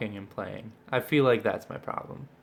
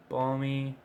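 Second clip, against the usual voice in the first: ordinary delivery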